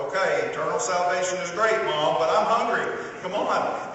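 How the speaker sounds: high, loud whining plea to mom; impatient kid begging to go eat; whiny hungry kid. Retold or imitated. Son voiced as hungry child.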